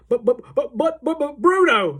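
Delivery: loud, high sputtering stutter; frantic stammer. A narrator's reading. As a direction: sputter helplessly in disbelief. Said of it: "stammered Mister Jenkins again".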